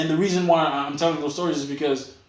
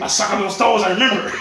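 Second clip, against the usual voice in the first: laughing mock prince; 6 semitones higher, 6 dB louder than usual.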